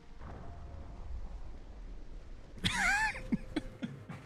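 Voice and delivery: high, silent, wheezing laugh. Real, not acted. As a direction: laugh with almost no voice. Burst of laughter after warning.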